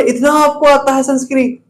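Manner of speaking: amazed high-pitched question; surprised. Retold or imitated. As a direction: sound astonished at what you heard. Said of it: "वो बोले". (हैरानी भरा ऊँचा सवाल)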